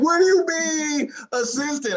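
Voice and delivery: hammed-up indignant girlfriend; mock outrage.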